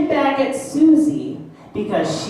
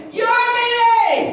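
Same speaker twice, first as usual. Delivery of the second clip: put-on high, slow kid yell; childish shout; 11 semitones higher, slower than usual. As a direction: yell it back like a sulky child. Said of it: "responds by saying".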